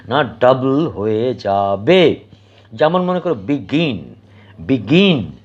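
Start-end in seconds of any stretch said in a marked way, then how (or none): 3.3-4.1 begin with light বি and strong গিন; stress on second syllable. Shown as a demonstration. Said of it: "জোর দিই না যতটা আমরা গিনটাকে দিই". 4.6-5.3 begin with weight on গিন; begin, its last syllable stressed and falling; stress on second syllable. Shown as a demonstration. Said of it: "জোর দিই না যতটা আমরা গিনটাকে দিই".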